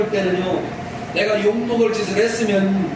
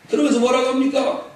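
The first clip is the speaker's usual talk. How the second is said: ordinary talk, nothing marked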